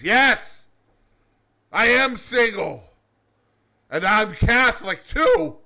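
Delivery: high, loud eager yelp; excited cab driver answering the nun. Acted. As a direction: burst out with eager joy. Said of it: "The cab driver is very excited".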